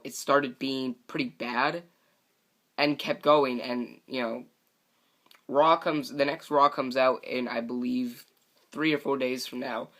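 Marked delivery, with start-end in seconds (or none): none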